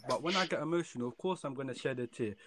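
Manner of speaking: heated, rising outburst; worked up and incredulous; irate. Real, not acted. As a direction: protest with real indignation, loud at first. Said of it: "those type of comments get me so irate".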